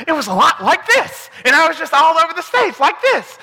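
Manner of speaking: high, wide-eyed hyper Elf voice; Will Ferrell's Elf. Shown as a demonstration. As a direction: bounce all over it like Buddy the Elf. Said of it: "Will Ferrell in Elf".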